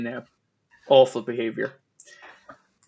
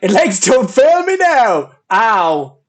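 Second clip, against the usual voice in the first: higher, louder, slow strained plea; hammed-up movie line; 4 semitones higher, 4 dB louder, much slower than usual. Quoting Paul Sheldon's line, hammed-up desperate plea. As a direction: ham up a desperate hero begging his legs.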